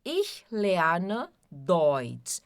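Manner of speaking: Ich lerne Deutsch very slow and careful; teacher models Ich lerne Deutsch slowly; slow pronunciation drill. Shown as a demonstration. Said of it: "já estão conseguindo fazer bem a pronúncia do ich". (Ich lerne Deutsch bem devagar para repetir)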